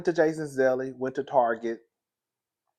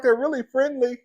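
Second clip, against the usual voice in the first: imitated high-pitched dog owner; reassuring neighbour; 11 semitones higher, 4 dB louder than usual. Dog owner to scared passer-by, imitated high reassurance.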